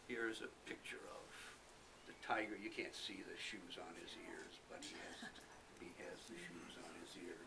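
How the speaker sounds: soft whisper to the listeners; whispered aside. Real, not acted. Reader to the children, whispered picture aside.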